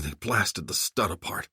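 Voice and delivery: ordinary delivery, nothing marked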